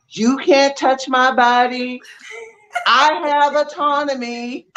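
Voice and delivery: put-on high-pitched little girl declaring her rights; imitating young daughter. Mother voicing her daughter at family functions, high and assertive. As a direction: pitch it up high, a child standing firm. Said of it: "she'd be like".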